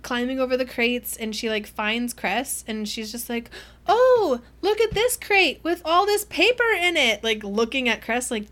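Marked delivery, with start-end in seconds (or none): 3.9-7.2 much higher and louder, sing-song innocence; overdone fake surprise. Winter faking surprise at the crate, in a high sing-song voice. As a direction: play the innocent loudly. Winter pretending to find paper.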